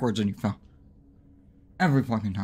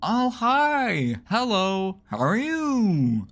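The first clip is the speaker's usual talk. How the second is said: much higher, sing-song hello; 8 semitones higher, much slower than usual. Real, not acted. Player cooing a much higher, sing-song hello to a cute creature. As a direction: coo at it like a pet.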